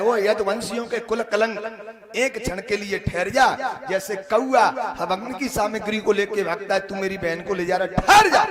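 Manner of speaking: loud acted challenge, building to a yell; shouted challenge. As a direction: order the fleeing man to halt, loudly. Brother shouts challenge at fleeing Krishna.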